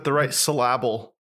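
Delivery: "syllable" said as suh-LAB-ul; misplaced stress. Shown as a demonstration. Co-host jokes about accent placement, "syllable" stressed as suh-LAB-ul. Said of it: "depends on where you put the accent".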